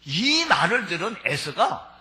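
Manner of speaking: normal talking voice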